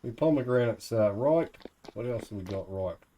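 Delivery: usual speaking voice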